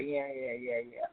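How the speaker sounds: soft, low, mumbled yeahs; uncle's mumbled yeah. Retold or imitated. Imitating the uncle who won't say love you back, mumbled. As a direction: mutter it back like a reluctant uncle. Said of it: "My uncle still say".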